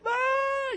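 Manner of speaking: high mock shriek of a child; preacher imitating a child's scream. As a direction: squeal like a kid about to be spanked. Child screaming before spanking.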